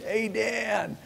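imitated yell. Plant workers greeting the visiting boss, imitated high yell. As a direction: imitate a drawn-out call from afar. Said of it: "people yelling at you".

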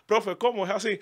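put-on eager boy asking his singing teacher; curious child. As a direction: play your younger self. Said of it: "siendo un niño digo".

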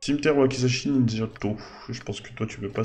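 normal talking voice

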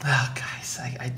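a breathy, mock-despairing whisper; exasperated whisper. Real, not acted. Host whispers in mock despair at co-hosts skipping ahead, exasperated whisper. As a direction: whisper it as if giving up on them.

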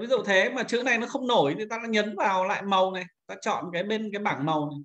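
ordinary delivery

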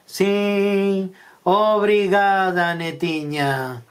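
very slow, drawn-out toothless thanks; mumbled granny act, long stretched vowels; toothless wolf as granny. Acted. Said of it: "mascarado de abociña e sen dentes".

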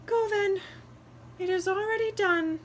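a small bird's weary sigh; high bird voice, sighing; wren sighing. A narrator's reading. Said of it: "the wren sighed".

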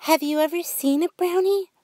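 put-on high, eager child's question; a wide-eyed little girl asking; curious girl voice. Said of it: "Brooke asked".

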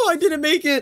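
high-pitched wail of defeat; dismayed cry. Real, not acted. Gamer just missing the next level, dismayed cry. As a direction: cry out as you fail. Failing the jump in game.

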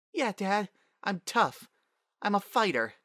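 put-on high-pitched plucky child voice; small high voice, proud and eager; little girl. A narrator's reading. Young daughter answering dad in memory.

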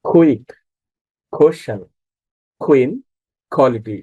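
কুইক, কোশ্চেন, কুইন, কোয়ালিটি each starting "kh". Shown as a demonstration. Teacher repeats Q examples for learners, Q as খ. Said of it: "খ রয়েছে".

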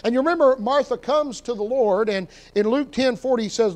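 ordinary talk, nothing marked

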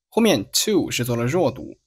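ordinary talk, nothing marked

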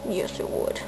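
soft whisper to a pet. Real, not acted. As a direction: whisper it to the bunny. Whispering to the rabbit.

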